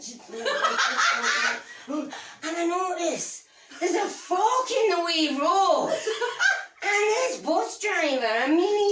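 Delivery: comic storytelling in bad Scottish, breaking into laughs; exaggerated Scottish brogue, milking every line; Billy Connolly. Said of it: "Scottish accent and vast love of Billy Connolly".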